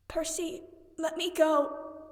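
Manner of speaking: weak hoarse plea, slow; croaked. A narrator's reading. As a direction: croak it out through pain. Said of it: "she croaked".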